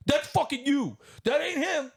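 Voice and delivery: very high, indignant mock yelling; hammed-up furious father hurling blame; imitating mad dad. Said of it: "He was so mad".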